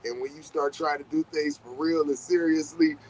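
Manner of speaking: usual speaking voice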